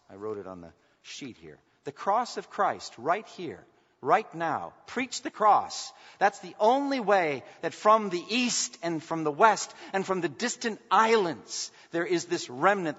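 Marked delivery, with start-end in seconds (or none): 1.8-13.0 slow, louder climbing proclamation; preacher swelling as he urges the church to preach the cross, fervent. Acted. Preacher building to full force.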